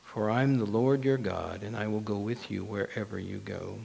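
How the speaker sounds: low, soft reassurance; calm a frightened listener, quietly and steadily; God's voice. Acted. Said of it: "And God says".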